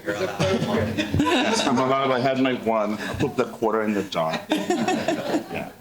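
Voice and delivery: giggly confession; laughing. Real, not acted. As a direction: laugh through the joke about the swear jar. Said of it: "I'm sure they can bleep it out".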